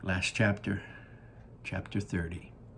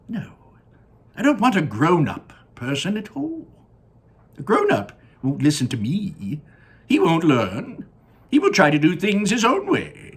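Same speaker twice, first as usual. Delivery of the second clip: Willy Wonka voice; 10 semitones higher, 6 dB louder than usual. Acted. Wonka gazing down at his factory, high and delighted. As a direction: sing it out like a proud candy maker.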